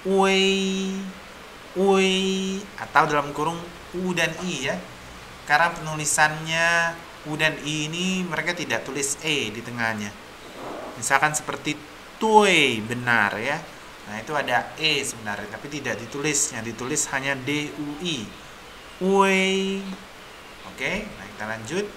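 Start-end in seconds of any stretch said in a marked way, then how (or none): 0.0-2.6 "Wei" drawn out high and level; diphthong wei. Shown as a demonstration. Teacher reading U E I, long high "Wei". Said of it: "ini bacanya Wei". 19.0-19.8 "Wei" held long on a high level tone; diphthong wei. Shown as a demonstration. Teacher recapping U I, long high "Wei". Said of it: "ini bacanya Wei".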